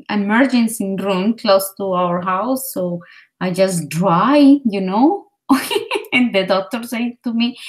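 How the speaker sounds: ordinary delivery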